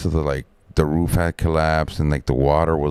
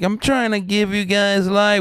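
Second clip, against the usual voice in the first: high, hollered protest; retold yell back; 14 semitones higher than usual. Worker defending himself to the crew downstairs, high holler. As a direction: call it back in self-defense.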